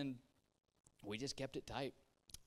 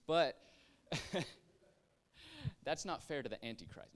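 ordinary talk

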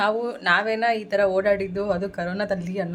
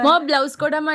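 higher, louder call to mum; raised-voice request, drawing out the first call; 8 semitones higher, 7 dB louder than usual. Real, not acted. Daughter asking mum to hand over the blouse, calling out.

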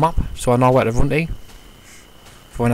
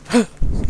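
quick higher gasp of surprise; sharp gasp; 8 semitones higher than usual. Real, not acted. Player spotting a key, gasping in surprise.

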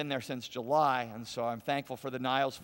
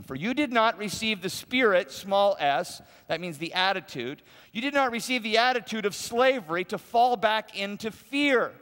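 forceful reading; 7 semitones higher, 7 dB louder than usual. Real, not acted.

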